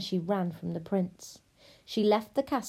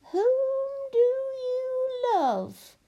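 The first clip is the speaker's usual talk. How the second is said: very high, long-drawn witch wail; demanding witch; 4 dB louder, much slower than usual. Acted. Witch demands the loved one's name, insistent. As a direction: stretch every word high and commanding.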